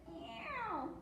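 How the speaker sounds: high, falling cat's meow; rabbit meowing. A narrator's reading. Storyteller as the rabbit who caught the meow, high meowing. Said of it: "said the rabbit".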